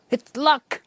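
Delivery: sudden high rising LUCK; excited realisation. Retold or imitated. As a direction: it clicks, leap up on luck. Realising the new title.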